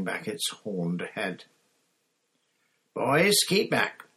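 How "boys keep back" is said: slow, higher, louder command; barked. A narrator's reading. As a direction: snap the order at the boys. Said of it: "barked Professor Grubbly Plank".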